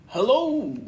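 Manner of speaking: Tigger's hello. Acted. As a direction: bounce the "Hello" up high as Tigger. Tigger answers Pooh's greeting.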